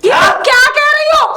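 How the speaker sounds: loud, high disbelief; shocked protest. Acted. Wife hearing a false accusation, shocked. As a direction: burst out at the false charge.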